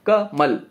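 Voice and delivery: कमल broken as "ka", pause, "mal"; कमल with a gap after the first syllable; split into syllables. Shown as a demonstration. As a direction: say कमल in two beats, short ka then mal. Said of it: "कमल को जब हम तोड़ेंगे".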